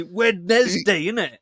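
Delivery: slow, drawn-out mock British accent; hammed-up British slang. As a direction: stretch Wednesday out like a parody Brit. Mocking British slang game.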